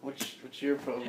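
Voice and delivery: soft, low confrontation; low challenge. Acted. Player echoes the challenge to the aristocrat, low and hushed. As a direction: challenge them under your breath.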